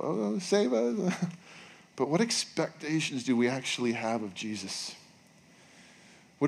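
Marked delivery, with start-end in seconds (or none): 0.0-1.1 slow, high mock plea; drawn-out singsong cry of the crowd. Pastor mimicking a crowd waving branches, slow and high.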